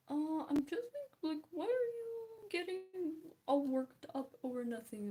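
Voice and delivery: high, loud whiny imitation; hammed-up protest, voice climbing high; mock indignation. Acting out dismissive people.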